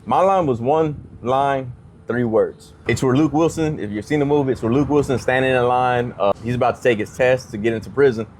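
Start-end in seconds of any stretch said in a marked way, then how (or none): none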